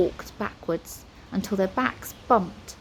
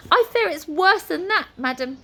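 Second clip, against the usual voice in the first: high-pitched, worried wizard; fearful wizard; 9 semitones higher, 4 dB louder than usual. A narrator's reading. Wizard warns Nine of worse, fearful. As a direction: break bad news in a high, anxious voice. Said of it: "Flabbergast gulped".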